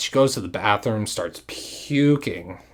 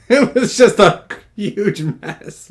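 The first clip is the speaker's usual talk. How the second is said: high, slow laughing words; 8 semitones higher, 6 dB louder, slower than usual. Real, not acted. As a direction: laugh through the line. Laughing while telling story.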